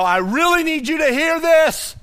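Jesus calling out to the crowd, loud. Retold or imitated. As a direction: call it out so all hear. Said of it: "letting everyone know".

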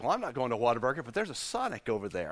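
low, soft and quick rationalizing; sneaky excuse. Retold or imitated. Pastor mimics himself dodging his own rule, low and quick. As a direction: mutter the loophole to yourself, fast. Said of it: "I was like".

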